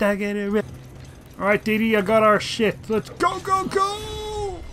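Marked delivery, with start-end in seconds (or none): 2.9-4.6 much higher, urgent go go go; excited rallying. Real, not acted. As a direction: spur the dog on, pitch shooting up. Rushing the dog out.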